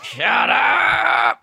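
high squealing mock shout; squealed shout. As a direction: shriek it in delight.

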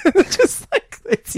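squeaky high laugh through words. Real, not acted. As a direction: laugh as you say it.

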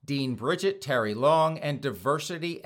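ordinary delivery

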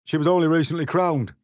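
a nervous card hurrying the words out; three of hearts. A narrator's reading. Three of hearts frets to Alice about the Queen, high and fast. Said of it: "the three of hearts added".

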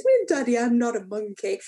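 lightly voiced little girl protesting in jest; child's joking protest. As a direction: playfully imitate a child correcting Daddy. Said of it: "it's always been said in jest".

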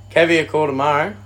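ordinary talk, nothing marked

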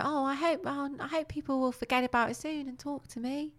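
high, soft, pitiful toy voice; mock-upset, high and small; upset Noddy. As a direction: plead sadly in a put-on girlish voice. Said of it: "getting really upset".

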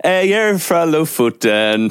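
put-on Norwegian in a thick English accent, high. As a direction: play an Englishman faking a Lofoten local. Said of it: "en engelskmann og begynt å snakke nordlending".

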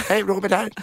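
high-pitched comic father voice; dad done in mother's high voice; imitated dad. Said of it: "Ett, ett samtal mellan din pappa". (ljus, tillgjord papparöst)

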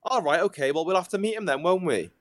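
put-on Welsh accent. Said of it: "you went more Welsh".

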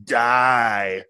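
loud hammed-up Boston voice; mock Boston accent. Host keeps up the Boston lobster joke in accent. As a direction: yell it like a Boston local.

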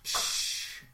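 long voiceless "shh"; shushing hiss. Real, not acted. Host silencing a co-host's remark, shushing hiss. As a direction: hush them with a drawn-out hiss.

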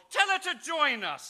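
high, loud summons across the table; posh host's call. Acted. Host at luncheon bidding the butler, loud and high. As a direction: call her in grandly.

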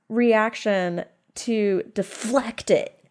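ordinary talk, nothing marked

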